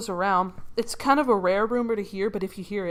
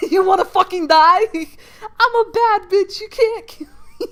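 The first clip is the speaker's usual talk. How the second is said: very high voice breaking into laughter; hammed-up high squeal, half laughing; squealed mock taunt; 10 semitones higher, 9 dB louder than usual.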